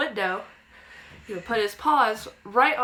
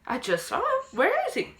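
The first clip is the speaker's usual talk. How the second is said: frantic searching; 14 semitones higher than usual. Retold or imitated. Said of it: "So I'm like".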